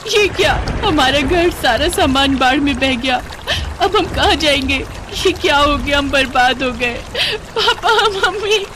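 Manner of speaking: high-pitched tearful lament, breaking into crying; distraught wailing. Acted. Daughter-in-law watching the house swept away, crying. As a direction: wail in despair, calling for the parents. Heroine wails as house floods.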